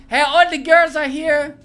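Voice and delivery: loud, high hammed-up ad shout; mock ad pitch. Host making a mock ad for Carlos, loud hammed-up pitch. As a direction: call it out like a cheesy advert.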